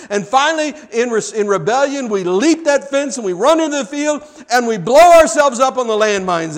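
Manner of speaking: high, loud preacher's climax; impassioned pulpit shout, easing at the end; full-force preaching. Real, not acted. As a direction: drive the story to its crash.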